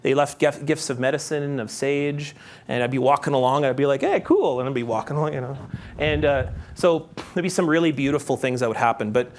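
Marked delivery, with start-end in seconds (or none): 4.0-4.6 quick, high-pitched little exclamation; pleased retold reaction. Walker retelling finding roadside gifts, pleased high reaction.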